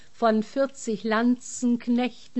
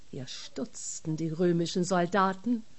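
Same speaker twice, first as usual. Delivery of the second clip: plain reading voice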